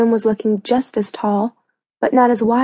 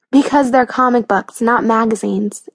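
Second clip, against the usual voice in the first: plain reading voice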